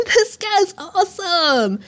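high, slow impressed exclamation; lightly voiced fan crowd; admiring cheer. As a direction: hype up the new kid. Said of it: "everyone else is like".

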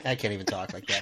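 usual speaking voice

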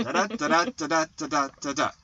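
"da-DA-da-DA", every second "da" stressed; iambic rhythm. Shown as a demonstration. Husband tapping out iambs for co-host, iambic rhythm. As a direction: beat out "Da-da-da" with weak-strong pulses. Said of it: "unstressed, stressed".

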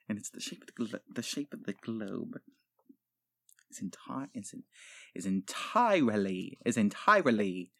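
very soft, low, halting reading; sounding out quietly. Acted. Sounds out sentence under his breath.

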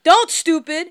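sharp scolding yell; mock yell. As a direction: snap it out loud like a retold drunk yell. Drunk friend yelling retold.

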